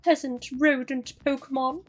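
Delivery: high, loud, slow haughty posh English; posh lady voice. Acted. Posh Pokemon addressing a peasant rodent, haughty posh lady voice. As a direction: look down on them in exaggerated posh tones. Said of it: "Posh lady voice".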